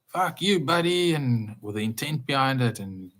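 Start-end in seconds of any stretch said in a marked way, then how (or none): 0.1-1.1 mock road rage. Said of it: "The words we use towards that guy".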